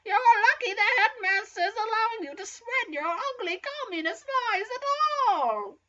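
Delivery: high, scolding teacher voice; Professor McGonagall. Acted. Teacher berating a pupil's ideas, high scolding voice. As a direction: scold in a high voice, falling away at the end. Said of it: "said racist Professor McGonagall".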